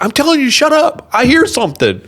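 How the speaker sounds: loud, high imitation of an agitated friend; urgent insistence. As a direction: demand silence at full pitch, acted out. Friend demands silence, retold.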